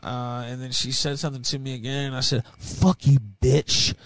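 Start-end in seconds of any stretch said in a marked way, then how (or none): 2.6-3.9 louder, spat right in her face; retold yell at the woman on the plane; retold loud curse. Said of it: "loud as fuck".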